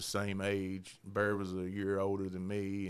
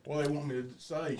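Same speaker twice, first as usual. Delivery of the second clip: ordinary talk, nothing marked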